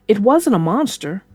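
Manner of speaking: plain reading voice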